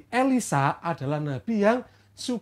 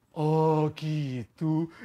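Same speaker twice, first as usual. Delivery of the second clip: lower, slow understanding reply; Elisha role; 5 semitones lower, 5 dB quieter, much slower than usual. Acted. Elisha takes in the student's story, low voice. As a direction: drop the pitch to answer as the prophet.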